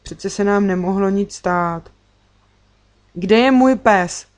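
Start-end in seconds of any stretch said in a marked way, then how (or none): 3.1-4.3 loud, drawn-out urgent question; insistent child's question. A narrator's reading. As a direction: demand an answer, louder and slower. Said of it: "Opakoval Niky naléhavě svou otázku".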